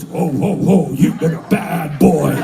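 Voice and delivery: a mock-stern Santa scolding a stoned kid; spooky Santa. Santa in a pot hallucination.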